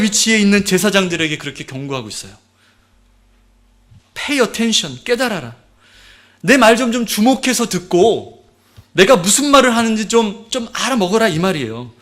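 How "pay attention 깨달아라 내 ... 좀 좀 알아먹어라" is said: strong warning. Acted. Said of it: "좀 집중해서 들으라는 거예요".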